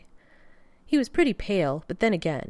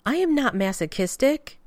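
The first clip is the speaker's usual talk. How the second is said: indignant denial; 8 semitones higher, 5 dB louder than usual. Acted. Rodney indignantly denies.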